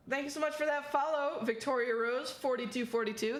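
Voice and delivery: normal talking voice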